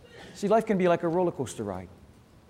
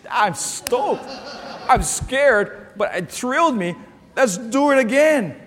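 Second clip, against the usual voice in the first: thrilled rider; 5 semitones higher than usual. Retold or imitated. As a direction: play the stoked rider begging to go again. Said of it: "coming off the ride saying".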